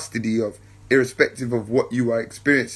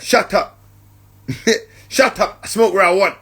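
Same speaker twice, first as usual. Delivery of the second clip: higher mock rude comeback; rude smoker retort; 5 semitones higher than usual. Smoker snapping back at the speaker, mock rude and higher. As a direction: wave them off like a rude smoker. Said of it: "Or he'll be like".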